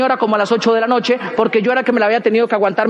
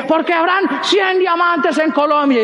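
slow, higher-pitched stage proclamation; rallying speaker; 4 semitones higher, slower than usual. Retold or imitated. Someone on stage rallying the crowd, imitated proclamation. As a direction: declaim it to fire up a crowd. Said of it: "sale alguien y dice".

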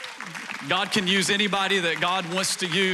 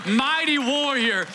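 rising proclamation; 7 semitones higher, slower than usual. Acted. God answering the doubter, rising proclamation. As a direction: proclaim each name like a promise. God declaring worth again.

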